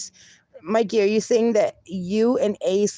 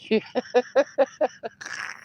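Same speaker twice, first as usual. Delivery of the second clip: laugh; 5 semitones higher, 3 dB quieter than usual. Real, not acted. Streamer cracking up at chat.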